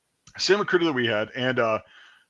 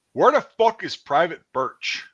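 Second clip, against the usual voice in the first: mildly raised, slow demand; high, drawn-out roll-call challenge; sergeant calling out; 6 semitones higher, 4 dB louder than usual. Retold or imitated. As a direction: imitate a sergeant hunting for a recruit. Sergeant calling him out at formation.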